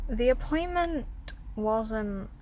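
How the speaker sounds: normal talking voice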